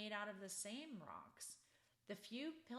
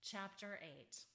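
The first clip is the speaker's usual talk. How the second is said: usual speaking voice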